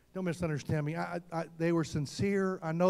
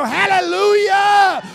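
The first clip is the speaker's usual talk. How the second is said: loud, high, drawn-out hallelujah shout; praise shout; 10 semitones higher, 12 dB louder, much slower than usual. Acted. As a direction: shout your praise slowly at the top of your voice. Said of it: "you hear this shout go".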